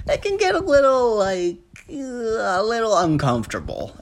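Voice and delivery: squeamish, high-pitched understatement. Real, not acted. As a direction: ease into it high and slide downward. Comic understatement about Texas snow.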